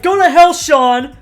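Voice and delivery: a loud, hammed-up snap at Sean; imitating Jade telling Sean off; mock angry yell. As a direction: throw it at him like a curse. Acting out the restaurant fight.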